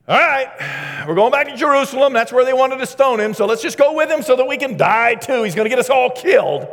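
high, shouted sarcastic exasperation; loud sarcasm. Acted. Thomas to the other disciples about a doomed trip, loud sarcasm. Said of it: "without a little bit of sarcasm".

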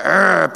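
short mock grunt of agitation; frustrated groan. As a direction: grunt like an angsty person. Acting out an angsty person.

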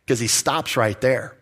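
normal talking voice

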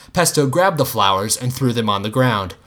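usual speaking voice

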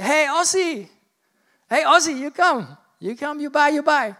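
put-on high, loud street seller in a poor Balinese accent; bad Balinese accent. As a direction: call out like a Balinese hawker, badly faked accent. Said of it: "that was meant to be Indonesian, Balinese".

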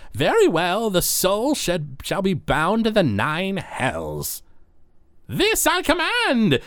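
high-pitched, slow judge pronouncing sentence; Bonaparte. Acted. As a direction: proclaim it high and drawn out, climbing to the final command. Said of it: "Bonaparte goes".

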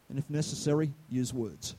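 soft understated tag line; quiet punchline. Real, not acted. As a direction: drop it to a soft aside.

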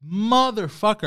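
exasperated mock groan of a fool who just got scammed; frustrated inner cursing. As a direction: drag each motherfucker out in self-disgust. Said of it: "I think to myself".

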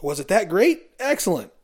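high, play-along salesman cheer; smiling fake enthusiasm. Salesman nodding along to a customer's stories, feigned enthusiasm. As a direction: humour the customer with a put-on smile. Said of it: "you smile and nod and say".

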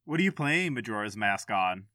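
usual speaking voice

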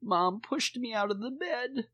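put-on high, whiny little-kid complaint; imitated crying child. Dad imitates his daughter tattling on mom, crying. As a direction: tattle in a mock tearful wail. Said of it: "she's crying and she goes".

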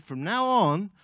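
solemn proclamation. Acted. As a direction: announce it as a big decree. Jesus renames Simon.

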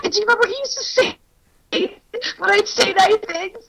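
high, fast mock retort; exasperated ex. Voicing the ex's annoyed reply, high and quick. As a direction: rattle it off like a fed-up boyfriend. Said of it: "And he'd be like".

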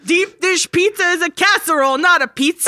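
loud New York Italian rant; New York Italian accent. Pretend. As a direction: scoff like a New Yorker. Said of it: "a New York accent".

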